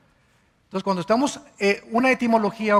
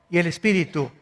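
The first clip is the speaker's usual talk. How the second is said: ordinary talk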